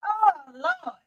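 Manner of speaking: ordinary talk, nothing marked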